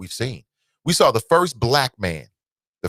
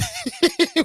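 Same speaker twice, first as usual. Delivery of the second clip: a quick laugh squeaked up high; short very high laugh. Real, not acted. Host reading a believer's post, short very high laugh.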